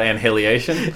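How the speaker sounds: "Annihilation" said as an-hilly-AY-shun; mock mispronunciation. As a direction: teasingly say "Annihilation" as "and hilly-ation". Teasing echo of the wrong pronunciation.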